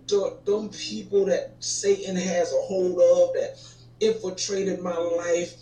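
ordinary talk, nothing marked

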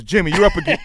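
loud, high, menacing gangster call; Pulp Fiction gangster voice. Retold or imitated. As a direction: bark it like a hitman boss. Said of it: "You just sounded like that dude in Pulp Fiction".

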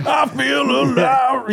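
high, slow hammed-up shout; mock shout. Singing along to Pearl Jam, a hammed-up high shout. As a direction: belt it out like singing in the garage. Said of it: "[name] come in".

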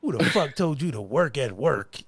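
low, soft imitation of a scolding wife; lightly voiced exasperated wife; mock scolding wife. Husband retells his wife scolding him, lightly mock exasperated.

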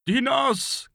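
very high urgent call; urgent cry. A narrator's reading. Father calling his missing son to hurry, crying out. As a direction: cry the name out to hurry him. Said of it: "cried Patras, with an exhortation for him to hurry".

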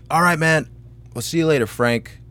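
breezy mock farewell, starting high; casual retold goodbye. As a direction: toss off a friendly sign-off, dropping on the name. Retold friendly goodbye from acquaintance.

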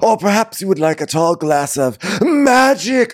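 high theatrical magician's offer; mock mysterious magician. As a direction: tempt him like a stage magician. Voicing Lisa as magician.

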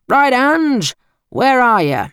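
loud, high call across the hall; brash uncle shouting for his sister. Acted. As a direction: bellow her name to the empty room. Uncle calls for Ange.

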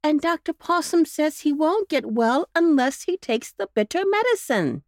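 a fussing mother explaining her sick pup; mother dog voice, a touch anxious; worried mother dog. A narrator's reading. Missus Bow Wow's worried lines.

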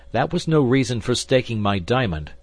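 angry reproach. A narrator's reading. D'Artagnan rebuking the gambler over his diamond, angry. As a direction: snap back in reproach.

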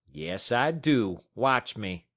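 slow, deliberate defiance; slow stubborn reply. Acted. Matt defying Scott, slow and deliberate. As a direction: drag it out, immovable.

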